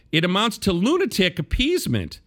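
high-pitched quoted complaint; colorful complaint. Retold or imitated. Host quoting a media critic's gripe about Pulitzers, mock-indignant complaint. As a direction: read out a columnist's grievance with mock indignation. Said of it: "Wempel colorfully complained".

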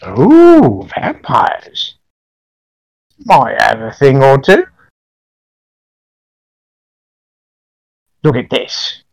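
high crone croon; very old woman. Acted. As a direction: savor it like a sly witch. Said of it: "very old woman".

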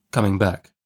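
plain reading voice

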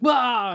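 short high yelp, hammed-up; quick cartoon cry of pain; mock yell of pain. Villain speared, cries out.

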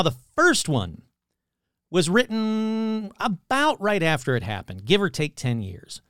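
usual speaking voice

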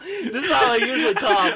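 laughing harder. Real, not acted. Host repeats to friends how he talks, cracking up. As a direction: say it again, now cracking up.

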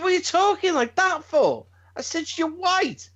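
high-pitched, exasperated disbelief; incredulous dad. Retold or imitated. Dad retelling his challenge to his son, incredulous. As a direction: question him in baffled high pitch. Dad's baffled retort retold.

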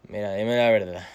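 doped-up patient pleading with the doctor; drugged voice. Retold or imitated. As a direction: ask like you're floating on painkillers. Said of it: "Con esta voz".

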